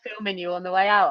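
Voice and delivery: ordinary delivery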